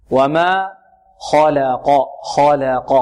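خلق with heavy kh and q around a thin la; correct light lam. Shown as a demonstration. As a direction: slowly model خلق, keeping the middle la light. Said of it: "واللام مرققة والقاف مفخمة".

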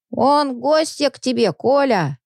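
high, slow granny call; old woman calling. Acted. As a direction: call out to the drunk neighbour. Old woman calls to drunk.

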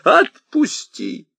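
high drawn-out shout. A narrator's reading. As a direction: yell to be let go. Old man struggles with soldier.

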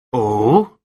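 mildly curious, rising "Oh?"; interested. A narrator's reading. Writer to detective about friend's words, mildly interested. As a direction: perk up with a touch of interest. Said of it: "Thompson sounded interested".

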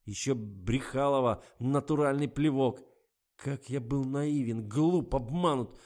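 furious rant. A narrator's reading. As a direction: pour out his humiliation in fury. Garik rants at his girlfriend.

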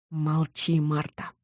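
soft, suppressed hiss; hushed, stern warning. A narrator's reading. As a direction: hiss it to shut someone up. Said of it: "прошипел капитан".

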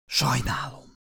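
soft, despairing near-whisper; desperate whisper. A narrator's reading. Father whispers his apology to everyone, despairing. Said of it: "suttogta kétségbe esetten".

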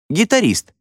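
high-pitched, excited call-out; shouted guess. A narrator's reading. Pupil guessing the mimed musician, high shout. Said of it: "прокричал Картрайт".

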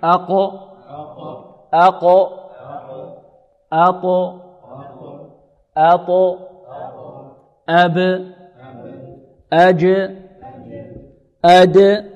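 each closing consonant in "أق، أط، أب، أج، أد" released with a bouncing echo; isolated drill syllables, bounce the stop at the end of "أق" and the rest; qalqala drill. Shown as a demonstration. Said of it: "حروف القلقلة كم يا إخوة؟". (نطق "أق، أط، أب، أج، أد" بقلقلة الحرف الساكن)

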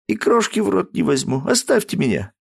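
high, mock-sobbing protest; lightly acted wail; hysterical crying. Daughter sobbing at her father, refusing food. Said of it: "истошный плач девушки".

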